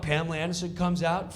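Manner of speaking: usual speaking voice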